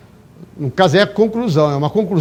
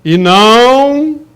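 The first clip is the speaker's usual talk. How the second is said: slow, loud and high, stretched out; emphatic reading; 5 semitones higher, 6 dB louder, much slower than usual. Shown as a demonstration. Teacher demonstrating to the class an emphatic reading. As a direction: read it with heavy emphasis, slowly. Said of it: "Eu tenho que ler assim".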